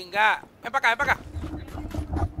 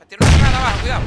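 loud warning; 10 dB louder, much faster than usual. Real, not acted. Grenade warning in game.

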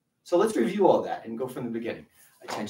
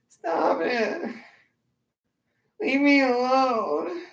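high, slow whiny plea with a laugh; whiny baby voice; 11 semitones higher, 3 dB louder, much slower than usual. Shown as a demonstration. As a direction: whimper it like a scared little kid. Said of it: "use a really whiny baby voice and say".

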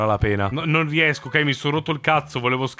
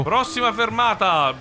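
higher, called-out announcement; bus announcer voice; 6 semitones higher than usual. Pretend. Driver announcing to passengers, mock announcer voice. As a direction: sing out the stop notice. Announcing next stop, put-on voice.

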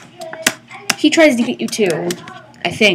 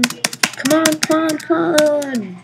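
tense, slow pleading; nervous waiting; scared horror game streamer; 4 semitones higher, much slower than usual. Real, not acted. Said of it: "So I'm just kinda waiting".

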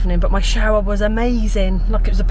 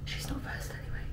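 whisper; 22 dB quieter than usual. Real, not acted. Sneaking up on the bride.

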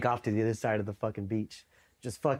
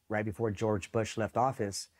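ordinary delivery

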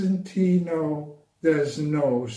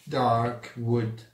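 usual speaking voice

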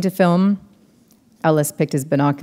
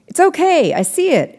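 high reassuring shout; 13 semitones higher, 5 dB louder than usual. A narrator's reading. Ellis reassuring Maddie across the boat, shouting. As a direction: call out that you've got it. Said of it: "Ellis shouted".

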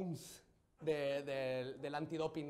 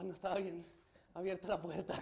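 usual speaking voice

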